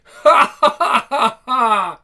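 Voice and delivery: loud helpless cackle; loud laughter. Real, not acted. Teammate still laughing hard. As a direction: keep cracking up, loud.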